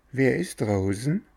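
a small, fine voice calling from inside; fine little voice. A narrator's reading. Cricket calls from inside to strangers, fine little voice. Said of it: "rief eine feine Stimme".

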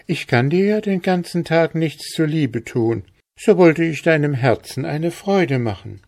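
a maiden eager to please; lightly voiced girl. A narrator's reading. Girl explains decoration. (hohe Mädchenstimme)

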